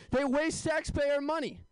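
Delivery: slow, high-pitched indignant punchline; mock outrage. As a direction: draw it out like a scandalised taxpayer. Mock-outraged punchline, breaking into laughs.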